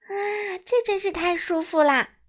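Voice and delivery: high-pitched exclamation of comfort; delighted cry. A narrator's reading. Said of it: "莱尼叫道".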